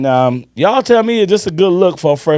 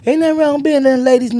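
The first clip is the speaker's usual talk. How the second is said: high mocking voice of scolding women; 13 semitones higher than usual. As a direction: imitate the women getting on his case. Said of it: "I had a lot of women get on my behind".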